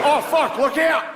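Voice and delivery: very high-pitched Australian alarm; mock-panicked Australian warning; high comic Australian yelp; Australian accent. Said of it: "an Australian guy in the office".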